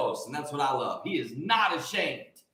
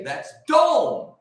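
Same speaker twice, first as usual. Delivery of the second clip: put-on child reading word; 7 semitones higher, 4 dB louder than usual. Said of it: "they would all say".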